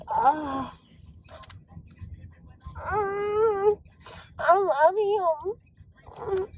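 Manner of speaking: a crying, gushing swoon; hammed-up lovesick cry; mock swoon cry. Real, not acted. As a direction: whimper out the love like overcome. Swooning over the man.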